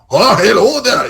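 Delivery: loud, high, harsh Scots; hammed-up harsh Scottish greeting; harsh Scottish accent. Shown as a demonstration. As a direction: belt it in rough Scottish. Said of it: "It's not like".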